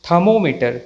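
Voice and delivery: थर्मोमीटर as "thuh-mo-meter"; silent r. Shown as a demonstration. Teacher models the correct word, first r silent. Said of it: "सही pronunciation है".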